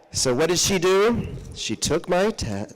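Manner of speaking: normal talking voice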